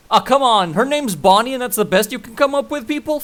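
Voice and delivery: high, loud indignation; exasperated protest. Real, not acted. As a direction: complain in comic disbelief at a lame name. Protesting the silly nickname in the game.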